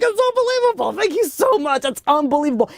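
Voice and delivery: high-pitched, gushing retold thanks; overjoyed gratitude. Father won't let the rabbi finish, overjoyed. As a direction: act out a father too thrilled to listen. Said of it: "He's so happy".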